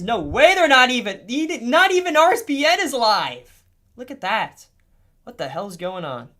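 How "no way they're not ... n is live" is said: high, loud, incredulous outburst; loud disbelief. Real, not acted. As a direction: raise your voice in total disbelief. Disbelief that nobody is live.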